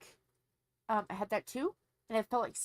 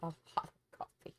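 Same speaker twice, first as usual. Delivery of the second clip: whispered aside; breathy whisper repeat; 3 semitones lower, 6 dB quieter than usual. Real, not acted. Whispered repeat of coffee line.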